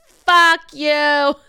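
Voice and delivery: loud, high mock-furious shout; hammed-up furious shout; outraged yell. Retold angry reply to partner's message.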